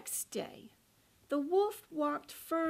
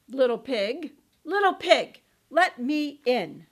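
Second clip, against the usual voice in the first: loud wolf call; 8 dB louder than usual. Acted. Wolf at the door.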